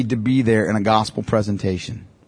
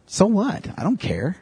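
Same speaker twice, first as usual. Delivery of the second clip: dismissive shrug; 6 semitones higher, 6 dB quieter, slower than usual. Pretend. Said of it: "Some people might go".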